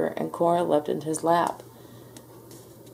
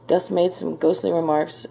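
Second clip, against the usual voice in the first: plain reading voice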